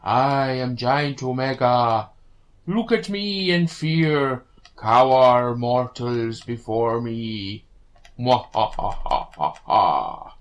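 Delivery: slow menacing giant villain voice; mad scientist. Pretend. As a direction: loom over the mortals, slowly. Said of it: "doing Giant Eno Form".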